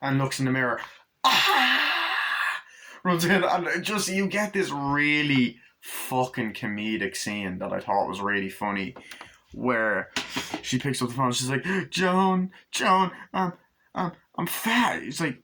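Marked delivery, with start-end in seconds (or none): 1.2-2.6 long high scream; mock-horrified "aaah"; imitated scream. Chucky screams at the mirror. 11.9-15.0 high, stammering phone wail; hammed-up high-pitched upset; mock distress. Acts Jennifer's distressed phone call.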